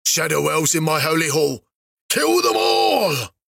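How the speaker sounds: building to a slow, roaring bellow; furious shout. Acted. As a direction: bellow the order to kill. King orders the assassins killed.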